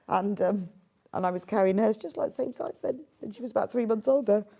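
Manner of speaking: normal talking voice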